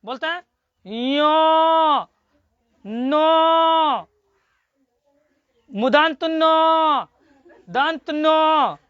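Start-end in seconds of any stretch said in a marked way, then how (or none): 0.8-4.1 यो and नो held long through the nose; nasal demo. Shown as a demonstration. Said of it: "बोलो नासिका में". 5.7-8.8 मुदंत नो, दंत नो said very slowly; slow demo. Shown as a demonstration. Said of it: "बोलो नासिका में".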